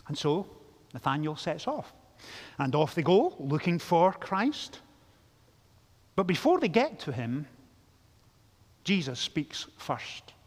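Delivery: normal talking voice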